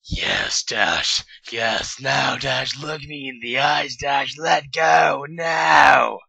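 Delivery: mounting loud cries; building urging. Acted. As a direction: urge her on, rising to a shout. Twilight urging at climax.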